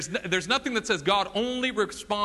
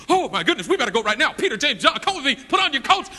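higher and louder, laughing frantic rush; hammed-up panic; 7 semitones higher, 6 dB louder, faster than usual. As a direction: overplay the hurry for a laugh. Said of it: "catastrophize with him".

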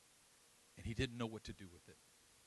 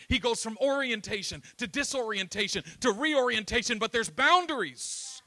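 loud, high, ringing preacher's cry; loud fervent sermon delivery; full-force preaching; 12 semitones higher, 17 dB louder than usual. Acted. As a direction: preach it out to the back row.

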